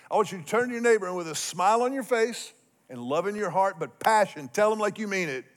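normal talking voice

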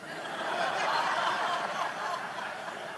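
high silent wheeze of laughter; breathless laugh. Real, not acted. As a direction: laugh without voice. Laughing at the family joke.

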